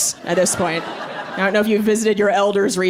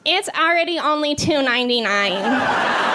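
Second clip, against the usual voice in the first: deadpan cashier; 5 semitones higher than usual. Retold or imitated. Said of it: "stared at me with dead eyes and was like".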